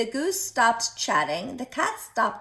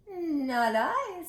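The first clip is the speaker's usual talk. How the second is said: high, lightly voiced cat refusing; a high little cat saying no; 9 semitones higher than usual. Cat turning down the hen, high and light.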